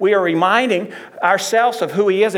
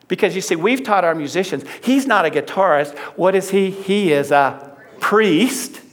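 ordinary talk, nothing marked

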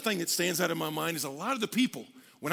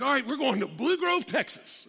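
higher, excited mock announcement; fired up; 6 semitones higher than usual. Young pastor announcing his first church, fired up. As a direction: announce it like thrilling news. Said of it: "but man, I was fired up".

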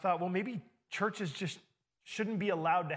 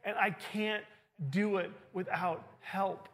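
raised, higher, louder, demanding to know why; angry pleading; 4 semitones higher, 4 dB louder than usual. Retold or imitated. A struggling disciple rebukes the others, loud and angry. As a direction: cry out at them for leaving you alone. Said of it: "they're angry with the other disciples".

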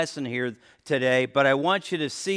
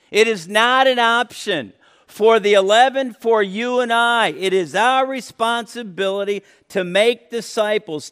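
higher, louder preacher's insistence; preaching at full force; 7 semitones higher, 9 dB louder than usual. Acted. Preacher urging the congregation to obey, fervent preaching. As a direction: drive the command home.

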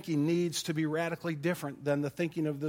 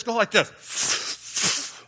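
very slow breathy drag on go like this; inhaling demo; 3 semitones higher, much slower than usual. Shown as a demonstration. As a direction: kid showing how to inhale a cigarette. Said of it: "You gotta go like this".

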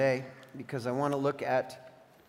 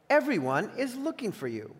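higher-pitched, mild exclamation; exclaiming; 7 semitones higher than usual. A narrator's reading. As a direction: call out the news with some urgency. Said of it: "they exclaimed".

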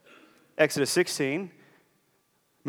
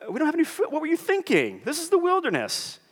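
much higher, mocking complaint; whiny grumbling Israelites; 13 semitones higher than usual. Preacher mocking the hungry Israelites, high whiny complaint. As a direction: whine at God like spoiled children.

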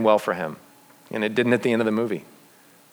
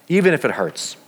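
ordinary delivery

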